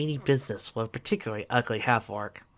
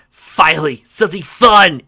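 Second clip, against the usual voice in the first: higher and loud; loud eager shout; gleeful battle cheer; 5 semitones higher, 13 dB louder than usual. Acted. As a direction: shout it with glee. Indigo joins fight gleefully.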